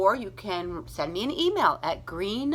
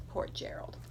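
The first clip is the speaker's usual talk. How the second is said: whisper; 11 dB quieter than usual. A narrator's reading. Sympathetic aside to children.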